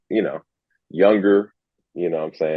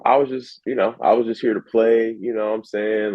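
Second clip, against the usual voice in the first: higher-pitched, casual brush-off; imitating a breezy shrug-it-off kid; put-on nonchalance; 7 semitones higher than usual. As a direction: act like winning never mattered. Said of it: "just super nonchalant and be like".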